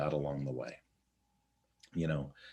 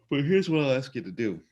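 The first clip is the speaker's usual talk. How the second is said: sigh, then much higher and louder; sigh then emphatic; 11 semitones higher, 9 dB louder than usual. Real, not acted. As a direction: break in with a sigh and push the point. Sighing restart, emphatic.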